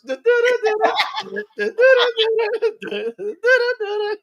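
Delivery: high, loud sung da-da-da riff; mock theme tune. Friend recalling Austin Powers, high loud mock theme tune. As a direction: belt out a silly spy-comedy theme in nonsense syllables.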